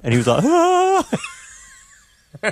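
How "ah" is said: put-on very high child-like "ah"; high, playful mock shriek; mock gleeful shriek. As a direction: imitate a kid's high squeal on being found out. Child revealing his hidden joke.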